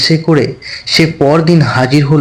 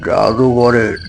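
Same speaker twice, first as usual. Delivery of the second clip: put-on old magician's voice; 2 semitones lower, 3 dB quieter than usual. A narrator's reading. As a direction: speak as a grave old master, unhurried. Old magician recounts his training.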